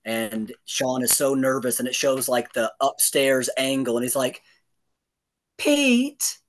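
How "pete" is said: high, timid calling out a name; cowardly call. Retold or imitated. Nervous Sean calling the roommate, cowardly and timid. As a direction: call him but lose nerve. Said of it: "so cowardly".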